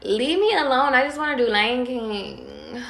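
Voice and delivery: mock-whine like a sulky kid; annoyed brush-off. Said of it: "some of y'all is about to be".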